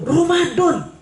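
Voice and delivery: very high, astonished cry; shocked exclamation. Retold or imitated. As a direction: squeal with disbelief over Ramadan. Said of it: "Saya bilang".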